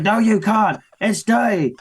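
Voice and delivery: put-on high, insistent little-girl protest; imitating arguing child. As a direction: mimic a bossy sister contradicting her brother. Voices daughter arguing with brother.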